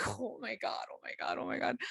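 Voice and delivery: soft, giggly muttering to oneself; hushed mock giggling; imitated private laughter. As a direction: act out laughing quietly to yourself. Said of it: "she's still laughing to herself".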